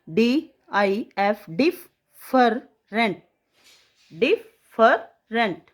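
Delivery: teacher exaggerating the double f; stretched double f. Shown as a demonstration. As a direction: hold the ff in डिफरेंट. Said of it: "double एफ की sound आ रही है".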